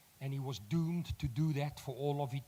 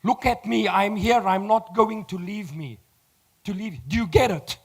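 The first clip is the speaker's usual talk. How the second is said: higher and louder, urgent but steady; urgent reassurance; 5 semitones higher, 9 dB louder than usual. Retold or imitated. Kali urging the panicking oak to look at him. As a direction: pull a panicking man back to you. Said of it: "Kali says".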